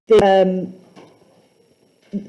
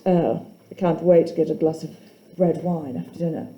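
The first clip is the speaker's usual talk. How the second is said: a quieter, lower hint of a grown-up looking forward to wine; mock adult; 4 semitones lower, 5 dB quieter than usual. Teacher jokingly voices an adult longing for red wine, quiet and low. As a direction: lightly play a grown-up savouring the thought of wine.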